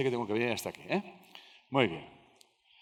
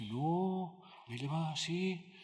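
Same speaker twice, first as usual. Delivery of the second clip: high, soft, drawn-out protest; softly stretched, higher-pitched mock denial; acted soft denial; 6 semitones higher, 6 dB quieter, much slower than usual. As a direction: imitate a wife gently waving it away, slowly. Acted wife denying mistreatment.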